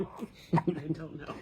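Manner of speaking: slow words through laughter; laughing. Real, not acted. Laughs at the question.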